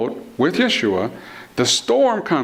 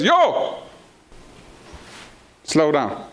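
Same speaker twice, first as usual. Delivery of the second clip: calling out; much slower than usual. Acted. Yeshua calls out to the storm.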